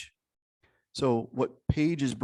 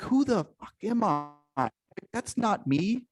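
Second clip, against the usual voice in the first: high, slow, horrified "who am I"; playing someone appalled at themselves; shocked self-questioning; 9 semitones higher, slower than usual. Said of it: "And they're like".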